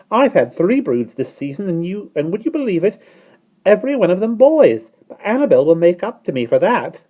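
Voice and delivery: chattering starling. Acted.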